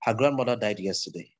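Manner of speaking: low, everyday speaking pitch; demonstrating low pitch, no lift. Shown as a demonstration. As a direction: keep the sentence down in your low range. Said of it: "Low pitch".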